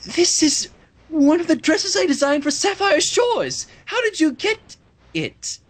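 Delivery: a hint of Rarity's shock; lightly voiced Rarity.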